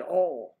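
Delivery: "All" loud, with a flat aw; "All" as the friend drawls it; Southern all for oil. Retold or imitated. Friend insisting, Southern all for oil. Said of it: "That's what I said".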